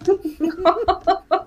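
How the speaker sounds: real burst of giggles; high, helpless laughter; amused laugh. Real, not acted. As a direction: let the laugh spill out. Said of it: "हंसी आ रही है".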